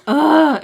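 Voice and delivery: loud, high groan of frustration; exasperated groan. Real, not acted. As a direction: groan loudly at the bad memory. Disgust at how loan went.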